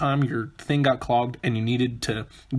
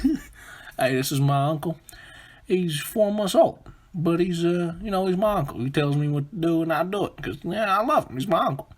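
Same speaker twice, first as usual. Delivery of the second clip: higher-pitched mock southern accent; hammed-up Southern voice, raised in pitch; guy from the South; 5 semitones higher than usual. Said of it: "it's a guy from the South".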